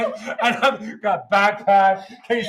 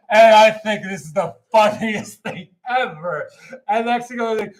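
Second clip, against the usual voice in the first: ordinary talk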